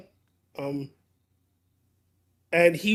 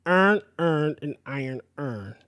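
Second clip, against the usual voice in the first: Baltimore accent, vowels running together; true Baltimore accent; 5 semitones lower, slower than usual. Shown as a demonstration. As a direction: say it the true Baltimore way, each word slurred into earn. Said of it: "if you're saying it with true Baltimore".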